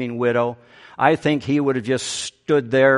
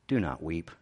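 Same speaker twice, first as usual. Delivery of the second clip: soft, low and tender; gentle; 7 semitones lower, 8 dB quieter than usual. Retold or imitated. Jesus gently telling the grieving widow not to weep. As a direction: comfort her quietly. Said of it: "he compassionately tells her".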